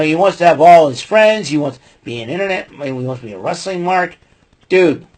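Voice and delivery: normal talking voice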